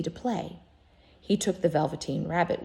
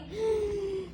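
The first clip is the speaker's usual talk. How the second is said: startled gasp; 13 semitones higher, 5 dB quieter than usual. Acted. Rabbit smells the toy.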